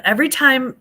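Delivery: loud, high excited burst. Real, not acted. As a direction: burst out eagerly.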